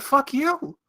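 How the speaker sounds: imitating Gus losing it at Layla; retold outburst. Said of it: "he told Layla".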